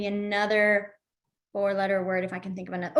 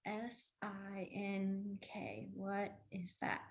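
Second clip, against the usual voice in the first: a confused student sounding out letters under their breath, far softer and slowed; slow hushed spelling out; 3 semitones lower, 18 dB quieter, much slower than usual. Retold or imitated. Teacher imitating a confused student spelling S I N K, hushed and slow.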